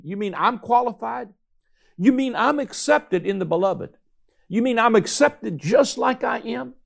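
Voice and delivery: lifted pitch, incredulous wonder; amazed questioning. Acted. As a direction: voice a believer's dawning astonishment. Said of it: "your faith leaps up and says".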